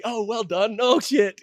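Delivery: high, loud praise that tips into panic; excited then alarmed. Retold or imitated. Replaying his mixed feelings on the drive home, excited then alarmed. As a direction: swing from pride to oh-no. Retold pride turning to dread.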